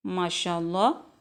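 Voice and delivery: usual speaking voice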